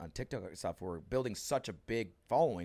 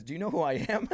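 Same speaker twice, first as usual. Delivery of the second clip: cocky boast; 7 semitones higher, 6 dB louder than usual. Retold or imitated. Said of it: "Said it kinda like that way".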